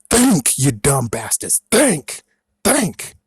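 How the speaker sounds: high, loud exasperated yell; exasperated shout. Real, not acted. Yelling at fans to use their heads, loud and exasperated. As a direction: shout it at them, fed up.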